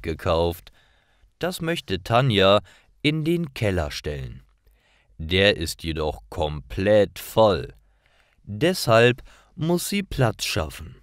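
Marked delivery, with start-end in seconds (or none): none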